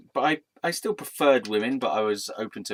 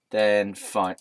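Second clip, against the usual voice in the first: ordinary talk, nothing marked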